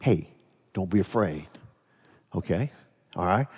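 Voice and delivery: quietly reassuring, like calming a scared crew. Retold or imitated. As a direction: soothe them gently, hushed. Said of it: "I've got this calming voice saying".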